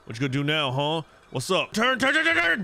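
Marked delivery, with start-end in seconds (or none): 1.7-2.6 high, loud panicked repeats; panicked urging. Real, not acted. Gamer steering fish in panic, high yelled turn.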